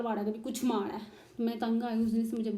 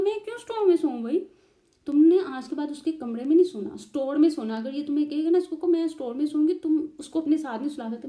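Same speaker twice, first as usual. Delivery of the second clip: higher, louder heated quarrelling; loud argument; 5 semitones higher, 8 dB louder than usual. Retold or imitated. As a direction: argue back loudly at him. Store में सोने पर झगड़ा. (ऊँची, तेज़ आवाज़ में झगड़ा)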